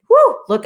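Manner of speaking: high, loud exhale of relief; big whew. Real, not acted. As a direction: blow it out after getting worked up. Worked up, blowing out breath.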